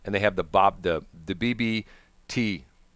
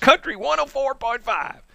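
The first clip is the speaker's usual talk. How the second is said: very high, drawn-out station ID; country radio DJ; 3 dB louder than usual. Acted. Ryan Seacrest plugging a country station, high hollered DJ voice. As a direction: sing out the country station's name, twangy. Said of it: "then you turn to the country station".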